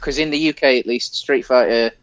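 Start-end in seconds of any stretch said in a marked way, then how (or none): none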